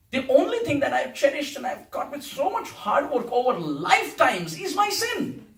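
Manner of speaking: high-pitched mock grievance to God; sarcastic complaint. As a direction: protest as if robbed of a treasure. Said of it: "This whole song is sarcastic".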